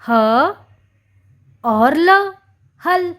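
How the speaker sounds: ह, then ल, then हल together; syllable by syllable. Shown as a demonstration. Teacher spelling हल aloud. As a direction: sound out हल in two parts. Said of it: "हल किस तरह से लिखते हैं?".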